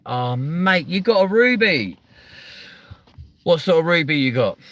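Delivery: real excitement over the curry; eagerly asking about the food; excited delight. Real, not acted.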